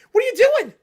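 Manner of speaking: high, loud exasperated shout; exasperated yell. Real, not acted. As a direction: yell it in disbelief. Ranting at the coaches.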